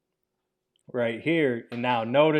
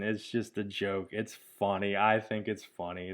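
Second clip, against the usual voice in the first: ordinary talk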